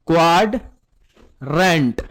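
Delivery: drawn-out, low quadrant said for pupils to repeat; slow modelled answer. Shown as a demonstration. Teacher models the answer to his pupils, slow and drawn out. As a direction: stretch every sound of quadrant slowly.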